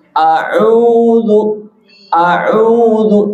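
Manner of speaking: A'udzu with lips pushed forward on u and zu; teacher showing rounded lips on A'udzu. Shown as a demonstration. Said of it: "zu-nya juga monyong".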